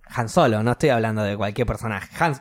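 ordinary talk

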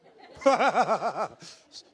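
loud, high rolling belly laugh; big real laugh. Real, not acted. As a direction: burst out laughing at yourself. Laughs at own lost wealth.

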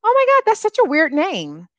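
high-pitched gushing imitation; put-on astonishment; mock amazed reaction. Mimicking people's reaction to her name.

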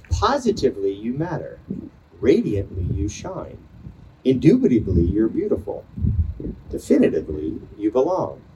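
pausing between short poetic lines; poem reading. Acted. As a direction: read each phrase like a line of verse. Reads a student's poem aloud.

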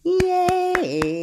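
high, drawn-out cheer; long sing-song yay. Real, not acted. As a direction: celebrate with the babies. Cheer after the greeting game.

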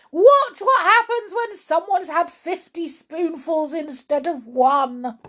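high, loud opening, easing slightly at the end; excited shout. A narrator's reading. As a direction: shout it out like a showman. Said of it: "Mister Cranky shouted".

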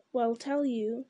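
ordinary delivery, nothing marked

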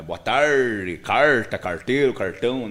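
caipira country accent with rolled, retroflex R on carta, carteiro, cartão. Shown as a demonstration. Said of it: "falar com R caipira".

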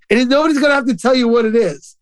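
usual speaking voice

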